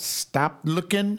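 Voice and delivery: normal talking voice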